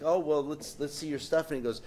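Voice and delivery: ordinary talk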